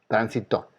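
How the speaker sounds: Tránsito said tran-si-TO; stress on last syllable. Shown as a demonstration. Teacher supposing another word, final-stressed Tránsito. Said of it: "Vamos a suponer que hice la palabra".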